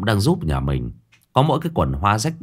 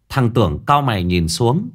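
ordinary delivery

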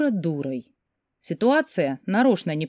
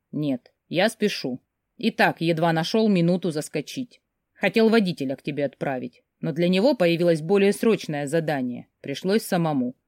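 plain reading voice

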